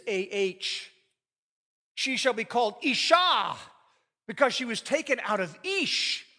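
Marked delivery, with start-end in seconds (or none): 1.9-6.3 loud and higher, ringing out Isha; excited. Acted. Adam naming his new wife, thrilled. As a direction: proclaim it with delight. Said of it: "Adam said this excitedly".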